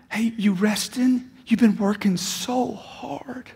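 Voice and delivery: slow, hushed, mock-tender whisper; mock caring whisper. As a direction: whisper it slowly like a fussing helper. Mocking a caring assistant.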